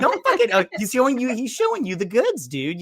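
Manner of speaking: exasperated yell at the prisoner; mock outburst. Fan yelling at the movie prisoner, high excited yell. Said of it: "I'm like".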